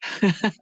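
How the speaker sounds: short real laugh; amused chuckle. Real, not acted. Laughs at chat.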